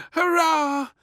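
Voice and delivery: very high, loud cheer; cheering princess. A narrator's reading. Princess cheers at no beans for lunch. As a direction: cheer like a delighted little girl. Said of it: "cheered Little Princess".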